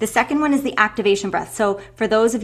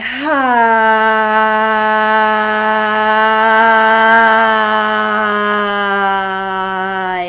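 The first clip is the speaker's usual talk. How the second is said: long loud exhaling sigh; big release sigh, all out; dramatic sigh; 8 dB louder than usual. Shown as a demonstration. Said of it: "a nice audible sigh".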